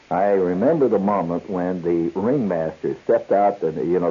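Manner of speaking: normal talking voice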